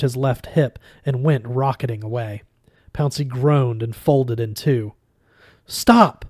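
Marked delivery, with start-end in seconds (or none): 5.7-6.2 high, loud cry of stop; sudden high-pitched yell; urgent shout. A narrator's reading. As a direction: shout it out sharply to halt them. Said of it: "Julia said".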